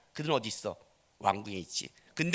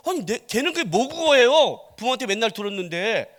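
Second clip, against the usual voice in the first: raised, loud acted protest; protesting retort; 4 semitones higher, 6 dB louder than usual. Preacher voicing a retort about a child's dialect, protesting. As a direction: argue back in a higher, louder voice.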